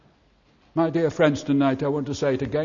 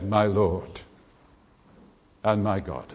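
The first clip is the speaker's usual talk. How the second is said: hushed awe; 8 semitones lower, 6 dB quieter, slower than usual. Acted. Blind man worships Jesus.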